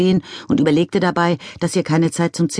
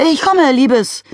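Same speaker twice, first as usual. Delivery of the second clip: resigned call; 11 semitones higher, 5 dB louder, slower than usual. A narrator's reading. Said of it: "rief Mister McCrumpet und ließ seine Schultern hängen".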